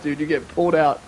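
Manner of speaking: ordinary delivery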